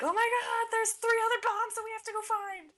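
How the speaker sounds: high, loud panicked yell; Donnie screaming. Retold or imitated. Donnie interrupting Leo about the bombs, frantic high yell. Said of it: "he's cut off by Donnie screaming".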